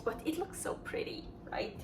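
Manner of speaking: soft unvoiced whisper, a bit quick. Real, not acted. Aside about the pretty sea salt.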